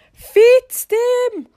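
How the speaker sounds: high, loud re-enacted yell; full-voiced mock shriek; retold scream. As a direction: scream it out to embarrass someone. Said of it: "när jag skriker i bilen".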